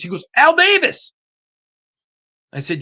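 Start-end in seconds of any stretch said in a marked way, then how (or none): 0.4-1.1 high and loud, thrilled fan; excited exclamation. Retold or imitated. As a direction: blurt the name out with delight. Danish fan names his hero.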